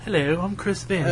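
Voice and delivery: hushed, slow accent impression; Chris Vent. Podcast host introducing himself, hushed accent impression. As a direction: impersonate a podcast host in a very soft, drawn-out accent. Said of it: "that was like the Chris Vent accent".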